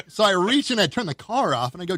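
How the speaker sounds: normal talking voice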